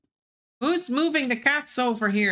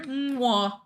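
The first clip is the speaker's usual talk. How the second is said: mock smacking kiss sound; kiss noise. Imitating the chat's kissing noise, mock kiss sound. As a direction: blow a playful kiss into the mic. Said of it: "That's what it sounds like".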